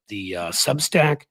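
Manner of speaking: ordinary talk, nothing marked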